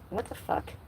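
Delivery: breathy whispered what the fuck; soft exasperated whisper at the house; whispered curse. Real, not acted. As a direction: mutter it under your breath, fed up. Annoyed at bad audio.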